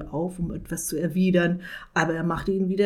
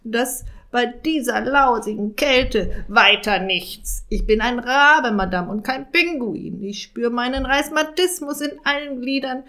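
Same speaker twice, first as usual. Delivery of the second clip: loud, higher raven moaning; grumbling raven; 5 semitones higher, 5 dB louder than usual. Acted. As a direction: complain like an aching old raven. Raven complains bitterly.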